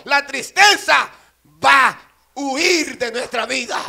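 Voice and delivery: loud, slow, emphatic proclamation; full-force preaching. Acted. Preacher proclaiming what falls in God's presence, slow forceful preaching. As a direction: hammer out each phrase with pauses between.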